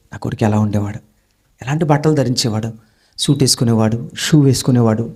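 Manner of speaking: grieving father. Acted. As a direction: mourn the son's fall under your breath. Father grieving seeing ruined son.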